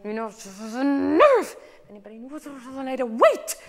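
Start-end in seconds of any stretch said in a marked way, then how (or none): 0.8-1.6 high, loud punch on "nerve"; "nerve" flung out high and loud; driving to exclamation. Shown as a demonstration. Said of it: "we want that drive to that exclamation point". 1.9-3.6 last word "wait" thrown out as an exclamation; driving to exclamation. Shown as a demonstration. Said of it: "we want that drive to that exclamation point".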